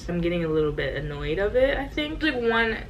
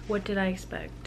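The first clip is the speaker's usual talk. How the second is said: normal talking voice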